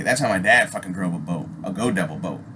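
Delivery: ordinary talk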